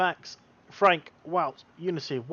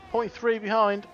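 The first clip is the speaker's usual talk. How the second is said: ordinary delivery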